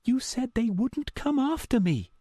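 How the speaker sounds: frightened, accusing female voice; scared and angry. A narrator's reading. Meredith confronting her caller, scared and angry. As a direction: hold back fear and anger. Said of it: "she sounded scared and angry".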